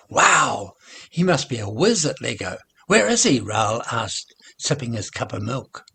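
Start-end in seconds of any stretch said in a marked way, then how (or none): 0.1-3.4 excited. Acted. Raoul excited about the grandson.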